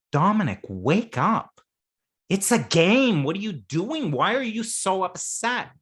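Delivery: exasperated friend. Retold or imitated. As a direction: snap him out of it. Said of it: "one of you says".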